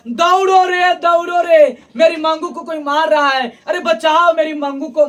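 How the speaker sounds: high, loud desperate shout; screaming for help. Acted. Mother screaming for someone to save her daughter Mangu, desperate shout. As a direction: yell for the village to come running. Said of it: "इतना जोर जोर से चिल्ला चिल्ला के बोल रही".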